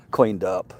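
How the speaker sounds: normal talking voice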